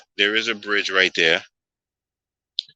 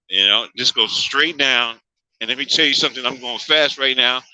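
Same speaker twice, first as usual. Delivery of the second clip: usual speaking voice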